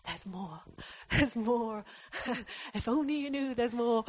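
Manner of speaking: laughing through a hammed-up Devonshire accent; amused imitation of a Devonshire accent; bad Devonshire accent. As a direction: mimic the pastor's wife in a broad Devonshire accent, giggling. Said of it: "she's got a really broad Devonshire accent".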